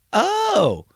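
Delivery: high, slow fake dawning; mock realization. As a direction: ham up a sarcastic aha, drawn out. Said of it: "And I go".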